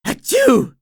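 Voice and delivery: loud high acted sneeze; big sneeze. SpongeBob sneezing at work, loud cartoon sneeze. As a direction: let the sneeze explode.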